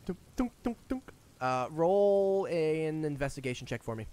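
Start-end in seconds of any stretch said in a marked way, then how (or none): 0.0-1.1 high little dunking sounds; imitated tapping. Game master voicing a tap on the glass orb, high dunks. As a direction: tap out hollow knocks with the voice.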